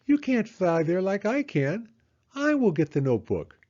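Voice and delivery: high owl voice, rising on you; a proud owl insisting; Owl's voice. A narrator's reading. Owl insisting he will fetch it, high and proud. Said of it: "said Owl".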